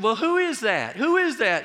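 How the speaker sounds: high, loud, insistent repeated question; urgent onlookers. Retold or imitated. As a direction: bystanders craning to see. Onlookers asking during choking.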